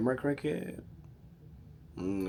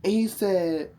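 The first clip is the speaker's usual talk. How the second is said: ordinary talk, nothing marked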